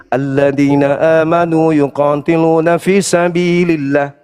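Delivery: slow chanted Arabic recitation; measured Quran chant; Quranic recitation. Acted. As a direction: intone the verse slowly, as in prayer.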